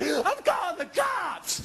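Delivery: a high, drawn-out cry for help; high, slow shout. Retold or imitated. Threatening his wife he'll call cops, slow high shout. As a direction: stretch it out in a high yell.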